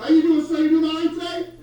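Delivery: high, loud and bright welcome; overly cheerful greeting. Acted. Officer greets the driver at the window, loud. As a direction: greet him like a game-show host.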